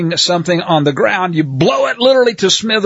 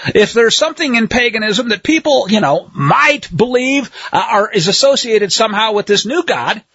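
ordinary talk

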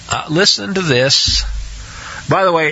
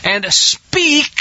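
"speak" raised high and leaned on; stress on speak; 9 semitones higher than usual. Shown as a demonstration. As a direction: hit the word "speak" hard and high. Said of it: "I'm gonna emphasize that".

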